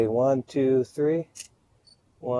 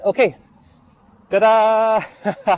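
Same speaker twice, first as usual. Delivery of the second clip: excited; 9 semitones higher, 6 dB louder, much slower than usual. Real, not acted. Proud reveal of finished shoot.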